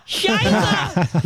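high shouted German swear; mock shout. Imitating classmates jeering at him in German, high shout.

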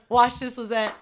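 rally your partner, building to a cheer; hyped rallying call. Retold or imitated. Golfer telling partner the cameras are on, hyped rallying call.

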